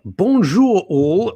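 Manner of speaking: slow, high French-flavoured bonjour; hint of a French accent; mock French greeting. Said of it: "[name]".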